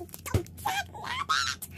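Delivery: very high, slow comic groan of disbelief; mock exasperated. Real, not acted. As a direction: stretch it out high like comic dismay. Another rare bag appears.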